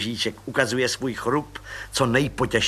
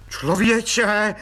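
breathless cry; 7 semitones higher, 3 dB louder than usual. A narrator's reading. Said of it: "vykřikl plukovník bez dechu".

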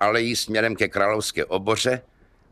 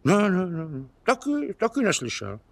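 zapírání; 9 semitones higher than usual. Acted. Kubát denies hearing.

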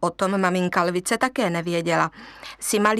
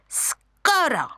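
high screechy monkey voices; screeching monkeys; 9 semitones higher, slower than usual. Acted. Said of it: "vřeštěly opice".

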